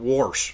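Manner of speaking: "wash." said as "warsh", an r slipped in; mock Pennsylvania "wash." rhymed with "porch". Teasing Pennsylvanians about how they say it, "wash." as "warsh". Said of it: "them people up in Pen".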